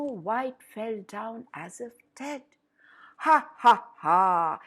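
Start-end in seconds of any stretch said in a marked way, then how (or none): 3.2-4.6 evil cackle. A narrator's reading. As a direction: cackle in triumph like a wicked queen. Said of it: "cackled the evil queen".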